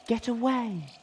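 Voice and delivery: very high, eerie long-held wail; howling wind voice. A narrator's reading. The haunted tree's wind moans at the intruders, eerie. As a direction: let the wind moan the words. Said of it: "howl of the wind seemed to form words".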